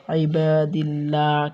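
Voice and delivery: thin double l with a clear aa in আইবাদিল্লাহ; light Allah sound. Shown as a demonstration. Teacher showing the rule after zer, light "llaa" in আইবাদিল্লাহ. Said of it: "জের থাকে তাহলে লা".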